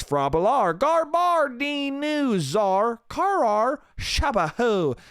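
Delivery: high, sing-song nonsense words; mock Simlish. Shown as a demonstration. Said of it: "not Simlish, that's".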